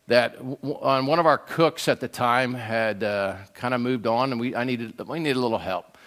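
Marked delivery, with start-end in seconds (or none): none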